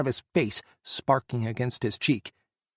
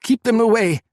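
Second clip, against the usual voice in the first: a sharp, much higher, loud bark; 10 semitones higher, 8 dB louder than usual. A narrator's reading. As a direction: snap the order out. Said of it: "Sasha barked".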